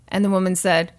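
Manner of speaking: ordinary delivery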